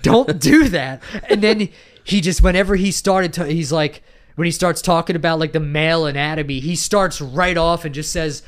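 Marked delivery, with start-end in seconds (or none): none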